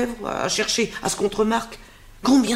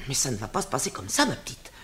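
ordinary talk, nothing marked

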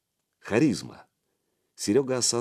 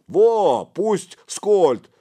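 high, loud, eager agreement; excited second voice; backing a friend; 11 semitones higher, 8 dB louder, much slower than usual. A narrator's reading. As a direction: chime in loudly in support. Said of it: "поддержал Чифаню Сычок".

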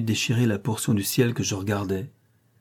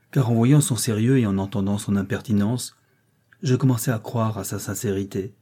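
ordinary delivery, nothing marked

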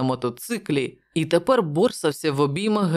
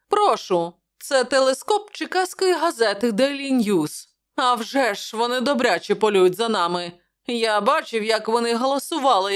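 higher, chatty guard at the gate; guard telling Malone about the reporters, higher and brisk; gruff guard, lightly voiced; 7 semitones higher than usual. A narrator's reading. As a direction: play the friendly gatekeeper gossiping. Guard keeps talking.

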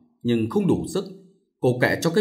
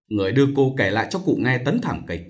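ordinary delivery, nothing marked

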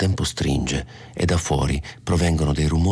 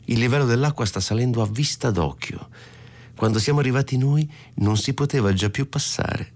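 wife's high chatty voice; 8 semitones higher than usual. Acted.

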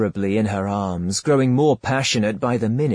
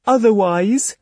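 pleading otherwise; 9 semitones higher, 4 dB louder than usual. Acted. Her pleading speech.

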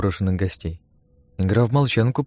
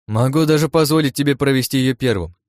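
raised, goading offer with a smirk; louder, lightly mocking proposal; 6 semitones higher, 5 dB louder than usual. A narrator's reading. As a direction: offer it like a cocky dare. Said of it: "усмехнувшись, предложил я".

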